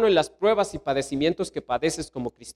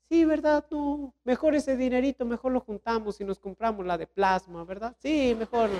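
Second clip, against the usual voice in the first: higher, listless agreeing voice; discouraged wife; 6 semitones higher, 5 dB quieter than usual. Acted. Wife agreeing to skip the retreat, listless and higher. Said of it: "la mujer también toda desalentada".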